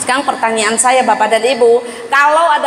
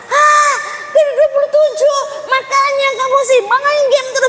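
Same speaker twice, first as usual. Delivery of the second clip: scolding mother; 13 semitones higher than usual. Retold or imitated. Mother scolds son over his rank, high disappointed shout.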